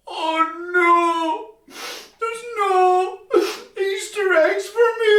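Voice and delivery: high, hammed-up wail of disappointment; mock-tearful little boy; dismayed child. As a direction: whimper like a let-down kid. Boy finds no eggs.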